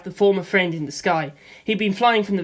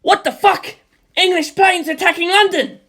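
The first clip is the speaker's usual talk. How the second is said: much higher, louder panicked yell; panicked shout; 12 semitones higher, 7 dB louder than usual. A narrator's reading. Friend hears planes attack London, panicked shout. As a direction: shout in shock and fear.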